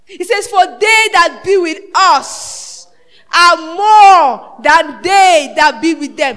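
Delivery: slow, loud, commanding declaration; bold proclamation. Retold or imitated. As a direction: boom it out like a prophet unafraid. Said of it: "Elisha got up and said".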